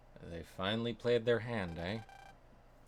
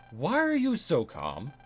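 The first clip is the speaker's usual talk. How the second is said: loud, slow, higher outburst; exasperated yell; 5 semitones higher, 10 dB louder, slower than usual. Acted. Fighter yelling at his unbothered rival, exasperated. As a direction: throw the question at him in disbelief.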